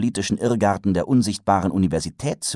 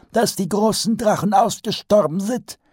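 much higher wizard voice repeating "dass die großen Drachen ausgestorben sind"; pompous old wizard; 4 dB louder than usual. A narrator's reading. Archchancellor repeats Vetinari's words in his high old voice.

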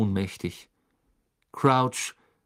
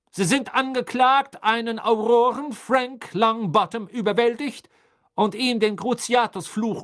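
loud, high, relentless prosecutor; raised voice; 10 semitones higher, 6 dB louder than usual. A narrator's reading. Crouch reads the charges over his son's plea, raised voice. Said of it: "mit erhobener Stimme".

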